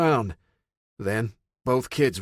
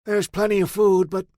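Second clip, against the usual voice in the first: put-on old man's voice; 11 semitones higher, 6 dB louder than usual. A narrator's reading. Old man warns Pat.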